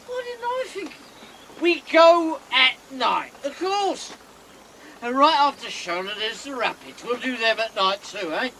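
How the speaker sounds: high mock-admiring praise, dripping sarcasm; exaggerated sarcastic enthusiasm. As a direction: pretend to be dazzled by her idea. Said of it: "there's no need to be sarcastic".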